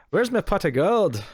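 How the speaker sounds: badly faked Irish lilt, drawn out; bad Irish accent. Teased as a leprechaun by co-host, hammed-up bad Irish. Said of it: "Are you a leprechaun?".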